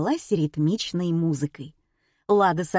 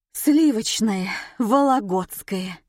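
mock moan, slowing down; dying voice; 3 semitones higher, slower than usual. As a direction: groan it out as if dying. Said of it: "умирающим голосом простонала Лада". (стонущим, умирающим голосом)

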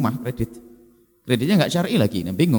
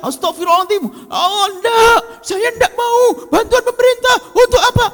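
high, loud showy outcry; hammed-up pious yelling; mock shouting refusal; 3 dB louder than usual. Ustadz loudly refusing government aid, hammed-up yell. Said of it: "Ngapain teriak-teriak".

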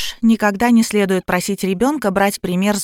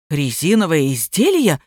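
low-voiced gruff aunt, mild bark; barked question; 5 semitones lower than usual. A narrator's reading. Aunt questions a stranger about the item, low mild bark. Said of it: "гаркнула тётка".

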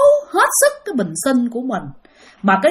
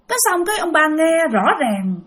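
usual speaking voice

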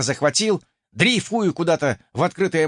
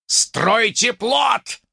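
slow, drawn-out snarled command; an unknown player snarling from the beach; growled order; 8 semitones higher, 3 dB louder, much slower than usual. Acted. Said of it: "буквально прорычал с берега".

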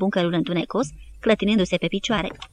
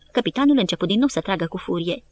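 usual speaking voice

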